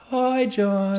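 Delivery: put-on high, sweet-old-granny greeting; put-on little old lady voice. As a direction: call out in a high grandma voice. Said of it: "this little old grey-haired lady comes".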